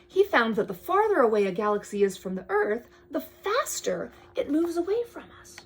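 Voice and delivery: storybook read-aloud, drifting to a hush; bedtime-story reading; reading to a child. Acted. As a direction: read the book to your kid. Parent reads picture book aloud.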